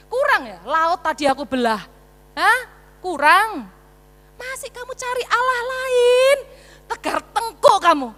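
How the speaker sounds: loud, high, scolding disbelief; exasperated God. Acted. God scolding stubborn Israel: loud exasperated rebuke. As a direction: rebuke a stubborn people, almost crying out. Preacher voices angry God.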